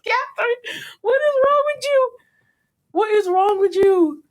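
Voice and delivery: shrieking at a character in disbelief; exasperated yell at the screen. Real, not acted. Fan yelling at Catherine on screen, exasperated.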